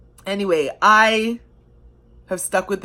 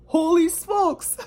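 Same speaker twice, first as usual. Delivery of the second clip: higher, slow, drawn-out astonishment; amazed holy smokes; 10 semitones higher, 3 dB quieter, slower than usual. Retold or imitated. Fan looking back on the team's history, slow high amazement. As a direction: let the disbelief stretch out high and slow. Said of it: "I'm like".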